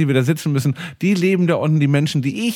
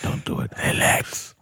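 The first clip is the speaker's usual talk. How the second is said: put-on hushed Russian-accented whisper; 6 semitones lower, 5 dB quieter than usual. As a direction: whisper it with heavy Russian menace. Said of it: "auf Englisch mit russischem Akzent ins Ohr geflüstert".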